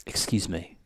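lightly imitated South African accent, a bit low. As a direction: approach a stranger with a hint of South African. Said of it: "he's got this South African accent".

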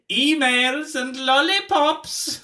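high, loud villain call; child catcher. Retold or imitated. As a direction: lure the kids with a sing-song cry.

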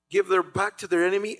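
normal talking voice

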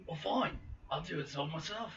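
very soft, low-key mock reply; barely voiced, resigned little grumble; grudging mutter. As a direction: mutter it under your breath, grudgingly.

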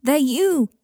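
crying out. Acted. Michael pleading with Draco, crying out. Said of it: "cried Michael".

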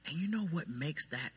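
ordinary talk

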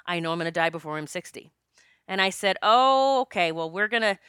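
ordinary talk

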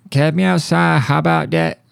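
high-pitched mimicry of a viral catchphrase; Bad Baby catchphrase. Host quoting the Catch Me Outside girl, high playful imitation. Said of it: "Whatever happened to Catch Me Outside, girl? Bad, bad baby".

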